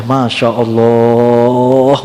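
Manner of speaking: low, slow, long-held marvel; drawn-out awe. Retold or imitated. Preacher marvelling at the heavenly maiden's voice, drawn-out awe. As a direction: linger on it as if lost for words.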